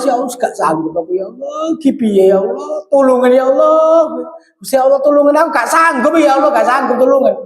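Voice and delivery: a mock-weeping plea, not real sobbing; tearful prayer. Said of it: "nangis wis nangis".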